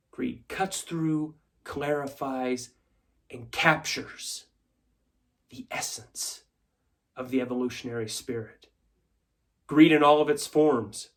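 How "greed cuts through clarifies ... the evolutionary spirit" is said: hushed credo. Acted. Shareholder preaches greed to stockholders, hushed and deliberate.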